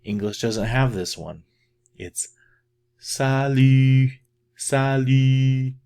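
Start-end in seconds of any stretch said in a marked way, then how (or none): 3.0-5.7 salut with lips rounded on an ee, higher and louder; French u in salut. Shown as a demonstration. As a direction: demonstrate the French u. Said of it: "You're gonna E".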